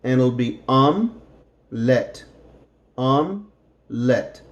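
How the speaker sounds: "omelette" as two syllables, "om-let"; American "omelette" in two beats; dropped middle vowel. Shown as a demonstration. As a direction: skip the first E in "omelette". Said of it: "will take this first E out".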